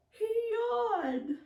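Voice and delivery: big stretched yawn, high and loud; exaggerated waking yawn. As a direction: act out a sleepy yawn. Acting out a bear waking.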